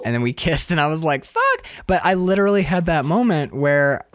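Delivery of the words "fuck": stunned exclaim. Retold or imitated. Exclaims after first kiss.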